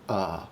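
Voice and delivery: "Uh" as a low, relaxed neutral vowel; relaxed schwa. Shown as a demonstration. Streamer demonstrating schwa to viewers, relaxed "Uh". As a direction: push air through a slack mouth for "Uh". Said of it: "relax your face and then push air through it".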